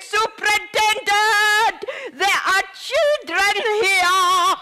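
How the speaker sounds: loud, slow urgent call. Retold or imitated. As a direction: call the superintendent across the hall. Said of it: "And I screamed at the superintendent".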